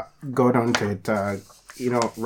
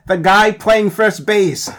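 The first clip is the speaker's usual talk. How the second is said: slow, loud, higher insistence; exasperated African accent; 8 semitones higher, 6 dB louder, slower than usual. Acted. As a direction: spell it out in frustration. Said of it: "Especially my African accent".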